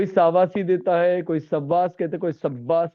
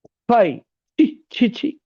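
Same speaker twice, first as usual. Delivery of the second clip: loud, higher "छी" of disgust; 6 semitones higher, 4 dB louder, much slower than usual. Shown as a demonstration. Teacher showing disgust with छी, louder. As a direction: spit out scorn. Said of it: "धिक्कार या निंदा जताने के लिए".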